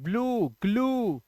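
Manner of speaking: ब्लू, ग्लू stretched slowly with a long ऊ; slow model words. Shown as a demonstration. Teacher showing students the long ऊ, slowly. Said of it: "यहाँ पर ऊ का उच्चारण".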